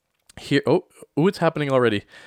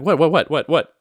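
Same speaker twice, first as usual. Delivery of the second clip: rapid-fire, raised-voice alarm; retold alarmed questioning; 5 semitones higher, 7 dB louder than usual. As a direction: fire it off in mock panic. Retold alarmed reply to friend.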